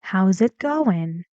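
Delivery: slow "How's it going", dropping at the end; falling intonation. Shown as a demonstration. Teacher reads slowly for pupils, falling "going". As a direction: rise into "going", then fall.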